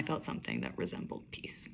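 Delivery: hushed, breathy closing line; whispered. A narrator's reading. Narrator whispering the chapter's last line, hushed.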